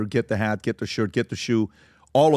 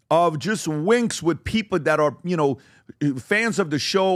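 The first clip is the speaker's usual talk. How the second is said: ordinary talk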